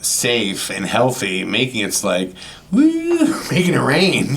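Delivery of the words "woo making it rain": high whooping, laughing party cheer; mock celebration. Mocking idea of success as throwing money, high laughing whoop.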